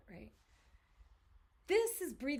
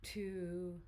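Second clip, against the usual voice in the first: guided breathing count; 3 semitones lower, much slower than usual. Acted. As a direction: count the breath out slowly, letting the voice drop.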